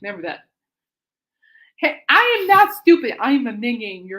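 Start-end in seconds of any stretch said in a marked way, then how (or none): 1.8-4.1 much higher, louder, cartoon protest; silly high voice. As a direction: squeak it like a minion.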